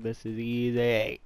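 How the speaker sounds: slow, drawn-out mimicry; lightly mock a cocky teammate, drawing it out; stretched-out imitation of a confident player; imitating Jorge. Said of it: "on the other side of his mic like".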